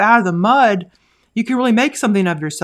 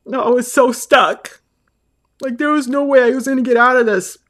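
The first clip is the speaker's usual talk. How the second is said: higher, choked-up confession; emotional; 4 semitones higher than usual. Real, not acted. Mother telling how her baby gave her hope, emotional. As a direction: overcome with real emotion, pitch lifted. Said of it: "I'm just getting so emotional".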